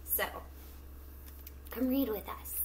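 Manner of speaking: usual speaking voice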